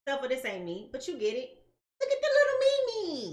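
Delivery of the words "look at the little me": very high, loud squeal; tiny high-pitched cutesy voice; squeaky cooing. Pretend. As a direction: coo it way up high, holding the last word. Repeats the cooing at the card.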